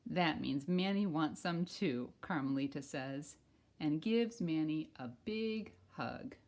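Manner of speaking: loud, bright storybook read-aloud; reading to children. Acted. Teacher reading a picture book to kindergartners, loud and lively. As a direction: read it big for a class of kids.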